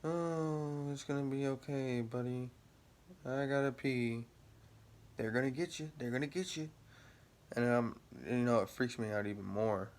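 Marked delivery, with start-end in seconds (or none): 0.0-4.2 high, drawn-out put-on voice; mock hallucinated companions. Original drivers answering him in the trip, mock drawn-out high voice. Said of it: "all they're saying is". 5.1-6.7 high put-on taunt; mock taunting companions. Original drivers freaking him out more, mock taunting high voice. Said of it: "all they're saying is".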